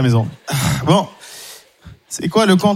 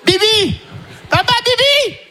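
put-on high repeated toddler cries of Bibi; shrill-free high insistent child calls; baby screaming for bottle, high; toddler demanding. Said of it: "il veut son biberon".

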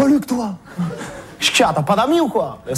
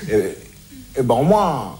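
much lower, softer, earnest voice; calmed-down sincere; 8 semitones lower, 3 dB quieter than usual. Acted. Reformed street guy shares his wise message, calm and low. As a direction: settle in and preach calmly. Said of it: "je me suis calmé".

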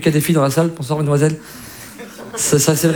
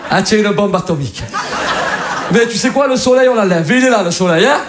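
Marseille flirt. Acted. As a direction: lay on a Marseille-accented pick-up. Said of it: "une fille qui se fait draguer à Marseille".

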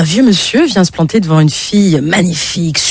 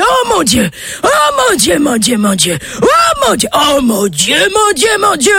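cris très aigus de vieux en pâmoison; hurlement joué; 11 semitones higher than usual. Acted. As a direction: hurle en boucle, voix suraiguë. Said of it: "il se met à hurler". (hurlement aigu et théâtral)

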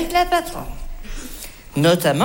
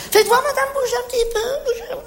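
high-pitched, coaxing shop patter; gushing salesman; 10 semitones higher than usual. Acted. Salesman coaxing a customer to move, gushing. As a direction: urge the customer on in a high, fussy voice.

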